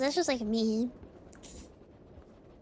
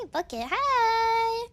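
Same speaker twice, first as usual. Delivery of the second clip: "Bucket, hi!" stretched very slowly; drawn-out greeting; 8 semitones higher, 4 dB louder, much slower than usual. Retold or imitated. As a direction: drag out the hello to the pet. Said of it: "and I was like".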